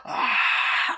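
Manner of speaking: loud, harsh mock scream; hammed-up anguished "ah"; imitated crying scream. Carrie cries as everyone laughs, imitated scream. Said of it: "starts kinda crying and going".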